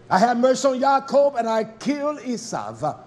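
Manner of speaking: normal talking voice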